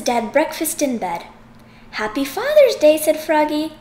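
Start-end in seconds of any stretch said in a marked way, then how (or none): 1.9-3.0 happy greeting. Acted. Froggy greets dad.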